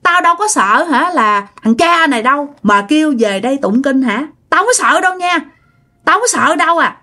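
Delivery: high, taunting spirit voice; mock and curse the family from the bed; possessed cursing. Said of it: "Bắt đầu chửi quá trời chửi luôn". (giọng ma nhập chửi khiêu khích, cao giọng)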